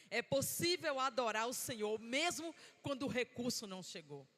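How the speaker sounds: usual speaking voice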